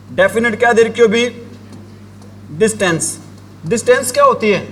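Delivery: usual speaking voice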